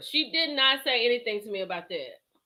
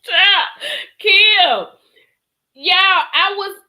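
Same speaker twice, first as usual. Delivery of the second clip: excited laughing outcry; 8 semitones higher, 9 dB louder, much slower than usual. Real, not acted. Cracking up, addressing the audience.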